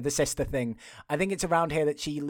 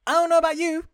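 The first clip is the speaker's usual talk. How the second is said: much higher, louder, incredulous protest; emphatic disbelief; 8 semitones higher, 5 dB louder than usual. Real, not acted. Host refusing the command herself, emphatic disbelief. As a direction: stress each refusal, high and loud. Host's indignant aside on the order.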